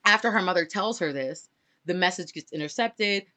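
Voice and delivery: ordinary delivery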